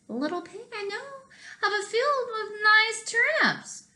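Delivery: high, sweet tempting wolf; sly wolf. Acted. Wolf tempts the little pig with turnips, high and sweet. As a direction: lure him in like a sly wolf.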